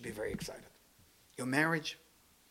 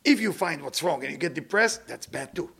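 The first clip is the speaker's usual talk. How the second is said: ordinary delivery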